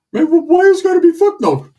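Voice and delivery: high, loud whining complaint; Ricky's whiny protest. Retold or imitated. Ricky protesting the cursing refusal, high whiny voice. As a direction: whine like a hurt kid.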